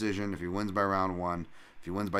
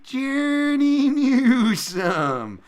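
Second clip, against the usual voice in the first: very slow, much higher, stretched-out name call; ring announcer; 11 dB louder, much slower than usual. Pretend. Streamer calls out the fighter like a ring announcer.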